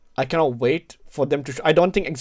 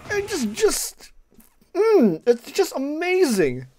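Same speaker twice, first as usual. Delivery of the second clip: awestruck; 10 semitones higher, much slower than usual. Real, not acted. Awestruck after trailer.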